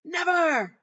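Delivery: loud, high shrieked refusal; defiant scream. Retold or imitated. Writhing boy yells his refusal at her, shrieking. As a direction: yell it back defiantly.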